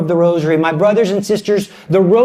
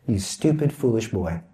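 mock scolding; 12 semitones lower, 9 dB quieter than usual. Mock scolding aside at son.

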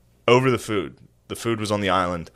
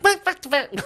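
high mock squabbling; high-pitched imitation of someone starting a fight; instant-fight outburst. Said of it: "just an instant fight".

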